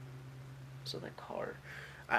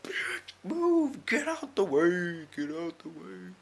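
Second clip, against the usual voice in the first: rude guy shoving past; 6 semitones higher, much slower than usual. Retold or imitated.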